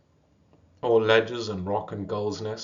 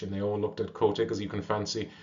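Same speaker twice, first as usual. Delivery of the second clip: plain reading voice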